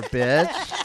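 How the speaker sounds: playful catchphrase. Acted. Hosts' catchphrase call to co-host.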